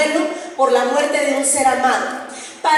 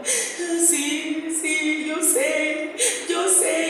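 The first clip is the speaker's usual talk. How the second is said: soft, higher, drawn-out whimper; slow tearful sing-song; weeping mother; 3 semitones higher, 5 dB quieter, much slower than usual. Retold or imitated. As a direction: imitate a mother agreeing through sobs. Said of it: "Haga de cuenta que esta es mi mamá".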